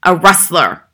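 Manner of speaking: loud volley of separate insults; screamed accusations. A narrator's reading. Headmistress piles accusations on the boy, screaming. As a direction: fling out each charge at a yell. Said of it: "the Bogtrotter screamed".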